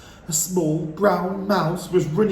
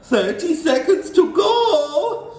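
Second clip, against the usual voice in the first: high, loud, slow sing-song countdown; gleeful witch counting down; witch crying out; 13 semitones higher, 8 dB louder, slower than usual. A narrator's reading. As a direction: chant the time like a gleeful witch. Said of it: "cried the Grand High Witch".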